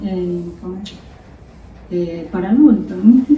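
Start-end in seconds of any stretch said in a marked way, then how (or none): none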